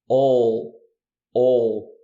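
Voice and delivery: loud, held "All" with the tongue pulled back; dark L. Shown as a demonstration. Teacher demonstrating the dark L in "All". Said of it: "Tenta falar um ou, só que sem o biquinho".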